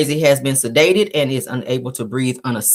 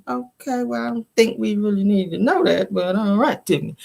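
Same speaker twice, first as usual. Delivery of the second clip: mock disapproval; 5 semitones higher than usual. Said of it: "I was like".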